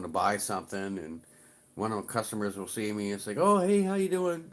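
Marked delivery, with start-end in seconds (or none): 3.4-4.5 high, loud mock customer hello; imitated eager greeting. Customer spotting the deli worker in the store, imitated eager greeting. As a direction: call out as if spotting a familiar face.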